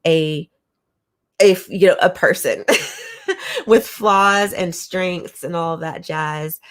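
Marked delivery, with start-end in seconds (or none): 2.7-3.6 high giggle. Real, not acted. Giggle amid talk.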